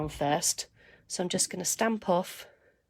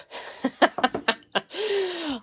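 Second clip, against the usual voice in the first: amused laugh; 9 semitones higher than usual. Real, not acted. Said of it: "Are you talking about me being pretty".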